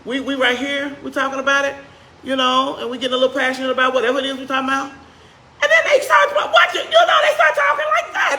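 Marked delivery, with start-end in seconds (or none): 4.9-8.4 much higher and louder, imitating a heated family debate; mock high-pitched squabbling. Preacher mimics relatives arguing, high mock shout. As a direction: shout it up an octave like relatives in an argument. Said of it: "When they take it that octave higher".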